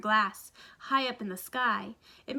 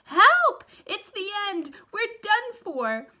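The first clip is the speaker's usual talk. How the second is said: very high, loud cry for help; panicked shout; 11 semitones higher, 9 dB louder, slower than usual. A narrator's reading. Grandpa Joe crashing through the roof, high panicked cry. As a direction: scream it as the roof smashes. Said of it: "Grandpa Joe shouted".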